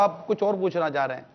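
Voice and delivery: ordinary delivery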